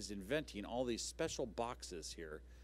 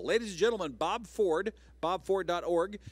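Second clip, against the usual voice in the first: big booming announcer call, much louder and higher; loud, pitched-up emcee introduction; announcer intro; 8 semitones higher, 10 dB louder than usual. Real, not acted. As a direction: sell the guest's name like a showman. Host introducing the guest performer.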